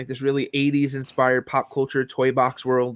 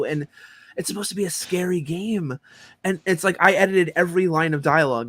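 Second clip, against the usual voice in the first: ordinary talk, nothing marked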